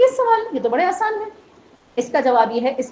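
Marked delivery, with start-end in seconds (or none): 0.0-1.3 higher, breezy scoff at easy questions; as if the questions are child's play; dismissive scholar. Retold or imitated.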